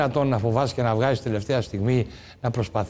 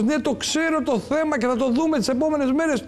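a light mock of the prime minister's voice, pitched higher; imitating the prime minister; 10 semitones higher than usual. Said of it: "είπε ο Πρωθυπουργός". (ελαφριά μίμηση του πρωθυπουργού, πιο ψηλά)

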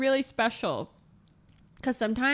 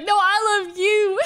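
put-on high, loud drunken wail of love; hammed-up tearful insistence; mock sobbing; 7 semitones higher, 8 dB louder than usual. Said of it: "And you're sobbing like".

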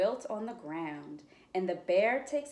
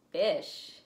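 ordinary talk

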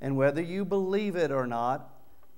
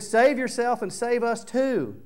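much higher, loud slow jeer; thief's mocking shout; 10 semitones higher, 7 dB louder than usual. Thief on the next cross shouting at Jesus, jeering. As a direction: scream the taunt at him. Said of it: "the thief mocked".

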